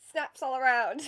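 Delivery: loud, laughing call for applause; mock audience cheer. Poet jokingly applauding her own poem, loud cheer. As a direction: call it out loudly, half laughing.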